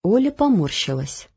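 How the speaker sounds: plain reading voice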